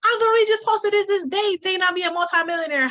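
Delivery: high, fast, tearful rush; overwhelmed woman crying to a stranger; retold tearful plea. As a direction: pour it out near tears. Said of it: "I start crying even more".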